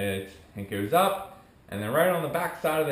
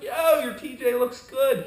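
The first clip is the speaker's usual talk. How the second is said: high, louder hyped-up buddy; admiring buddy voice, pitched up; retold excited compliment; 9 semitones higher, 6 dB louder than usual. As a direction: imitate a friend hyping the ride.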